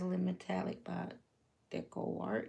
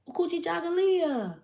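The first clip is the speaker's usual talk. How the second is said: "Ukuji Jagalia." shouted much higher and louder; proud call-back; 12 semitones higher, 11 dB louder than usual. Shown as a demonstration. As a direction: belt "Ukuji Jagalia." as a festive answer. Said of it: "and you'll say".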